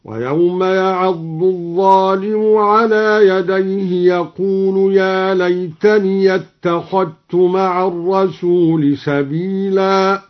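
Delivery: slow chant, ليتني with open ya and اتخذت merged to t; ya open, dhal merged. Shown as a demonstration. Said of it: "وأدغم الذال في التاء هكذا". (إدغام الذال في التاء في اتخذت)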